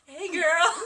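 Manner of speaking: put-on high, loud sassy hey girl; sassy greeting. Camp greeting impression.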